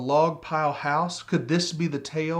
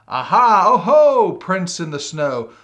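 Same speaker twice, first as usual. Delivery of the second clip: loud, high, thrilled cry of discovery; excited discovery; 4 semitones higher, 10 dB louder than usual. Acted. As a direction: shout it out like you've found a clue. Gruffalo's child discovering tracks.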